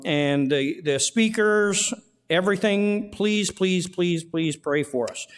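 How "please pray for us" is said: normal talking voice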